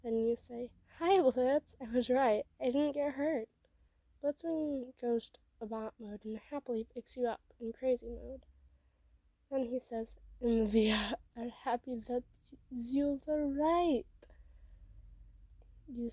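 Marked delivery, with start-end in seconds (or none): 1.0-3.5 loud, bright acted hello; upbeat and loud, proudly reassuring; cheerful greeting. A narrator's reading. Cheerful greeting after school. 10.4-14.0 crazy-mode robot. A narrator's reading. Blitzwing in crazy mode replies.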